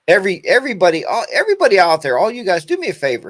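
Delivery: calling out loud. Real, not acted.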